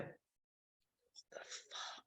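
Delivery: drawn-out, voiceless whisper; stunned whisper. Retold or imitated. Patient just told of cancer cells, stunned whisper. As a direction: breathe it out slowly in acted shock.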